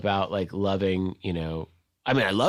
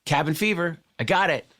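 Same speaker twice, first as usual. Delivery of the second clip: lightly imitated director, pitched up; Quentin Tarantino; 8 semitones higher than usual. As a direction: toss it out higher, as from a car window. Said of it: "and goes".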